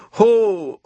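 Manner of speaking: loud, high hailing "Ho"; hearty call. A narrator's reading. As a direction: call out to the oysters across the sand. Walrus calling the oysters.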